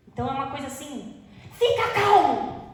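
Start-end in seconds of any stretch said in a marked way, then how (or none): 1.5-2.6 high, loud and slow, imitating a parent who is losing it; a hypocritical parent yelling at a child to calm down; mock yell. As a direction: bark the order to calm down while clearly not calm.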